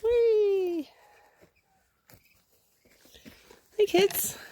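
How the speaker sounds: high, loud delighted whee; excited squeal. Real, not acted. Owner squealing with delight as her chickens flap. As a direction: squeal with joy at the birds.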